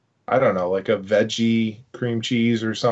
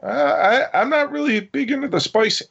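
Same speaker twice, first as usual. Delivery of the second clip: sudden high-pitched crack; 13 semitones higher than usual. Real, not acted. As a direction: voice jumps up and cracks. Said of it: "why were you so loud".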